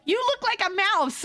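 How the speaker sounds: high-pitched imitation of a wife's exclamation; lightly hammed-up high female quote; friend's wife. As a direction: blurt it out high, as the wife did. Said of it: "his wife was like".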